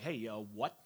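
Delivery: lightly voiced casual God, low and soft; a hint of a streetwise God nudging his people; mildly voiced God, low and easy; God's voice. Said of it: "God's a little bit like".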